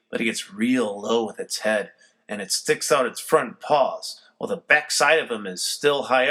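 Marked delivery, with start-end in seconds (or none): none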